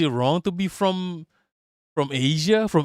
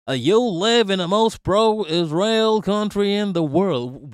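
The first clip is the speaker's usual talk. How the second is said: put-on slow, drawn-out Yankee accent, a bit higher; 4 semitones higher than usual. Repeating the commenter's line in a slow Yankee accent. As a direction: stretch it out in a Yankee drawl. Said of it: "let me try to do a Yankee accent".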